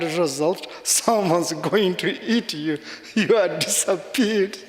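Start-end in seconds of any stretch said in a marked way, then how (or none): 3.1-4.7 breaks into laughter at the end; laughing punchline. Real, not acted. Telling the priest the punchline, laughing. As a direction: land the joke and crack up.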